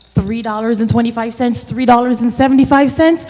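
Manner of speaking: storyteller building toward the total; children's story telling. Acted.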